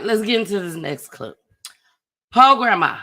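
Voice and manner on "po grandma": loud pity. Real, not acted. Exclaiming over next clip.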